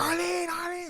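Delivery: high, loud frantic scream of a name; panicked yelling across the marsh. Retold or imitated. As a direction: call out desperately for a missing sister. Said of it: "screaming for myself".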